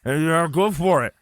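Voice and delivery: talking through a full mouth. A narrator's reading. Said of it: "struggling to talk with my mouth full".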